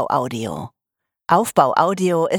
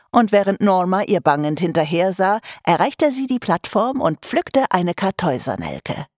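usual speaking voice

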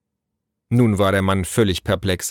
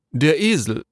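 higher, disapproving official voice; lightly voiced haughty envoy; stiff messenger; 6 semitones higher than usual. A narrator's reading. Messenger pointing out a donkey to Naru, stiffly.

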